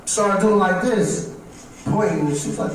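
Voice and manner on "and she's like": ordinary talk, nothing marked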